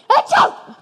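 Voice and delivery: big high sneeze; loud sneeze. Acted. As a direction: let out an explosive sneeze. Allergic pig sneezes again.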